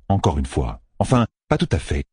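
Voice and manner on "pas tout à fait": ordinary delivery, nothing marked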